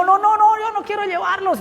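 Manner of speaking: high, rapid-fire no-no-no refusal; emphatic refusal. Retold or imitated. Father refusing his kids a ride, high-pitched and insistent.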